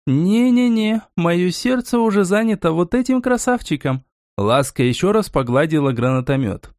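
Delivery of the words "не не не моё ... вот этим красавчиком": high-pitched woman, smitten with her weapon; playful refusal. Acted. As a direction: wave off the offer in a high female voice. Woman fondly refuses another rifle. (высокий женский голос, игривый отказ)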